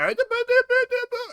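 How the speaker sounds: very high gibberish noises; imitated kid babble. Co-host demonstrates the skit's overlapping voices, high babble. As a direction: rattle off squeaky nonsense syllables, loud. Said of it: "So you're hearing the".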